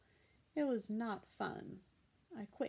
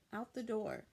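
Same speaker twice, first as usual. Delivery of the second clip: ordinary delivery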